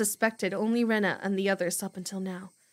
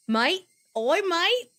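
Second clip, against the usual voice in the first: slow, drawn-out mock Australian; Australian accent; 7 semitones higher, much slower than usual. Streamer repeating only Aussie phrase, slow mock accent. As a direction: stretch out the Aussie oi mate. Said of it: "that's all I know how to say".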